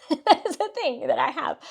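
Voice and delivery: amused laughter. Real, not acted. Host waving off her own digression, amused laughter. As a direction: laugh your way through the aside.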